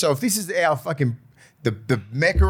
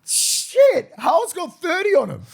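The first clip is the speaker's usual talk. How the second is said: high-pitched, louder burst of disbelief; amazed reaction; 11 semitones higher, 4 dB louder, slower than usual. Real, not acted. Co-host reacting to a surprise rugby score update, amazed. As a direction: exclaim in real astonishment at the score.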